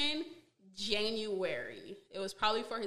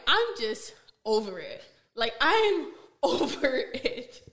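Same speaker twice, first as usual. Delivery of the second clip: louder, laughing through exasperation; 3 semitones higher, 7 dB louder, slower than usual. Real, not acted. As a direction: laugh it off, fed up. Laughing while saying she's done.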